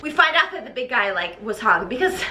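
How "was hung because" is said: normal talking voice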